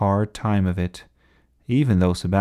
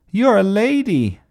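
high, loud taunt; crying out; 12 semitones higher, 5 dB louder than usual. A narrator's reading. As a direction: call out the insult high. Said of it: "cried Ernest".

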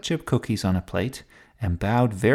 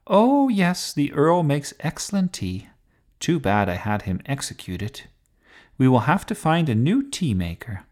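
a touch of breezy royal imperiousness, lilting higher; lightly voiced haughty queen; 7 semitones higher, 4 dB louder than usual. A narrator's reading. Queen chatting about her executed tea maker, lightly imperious. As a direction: play a light storybook queen, blithely grand.

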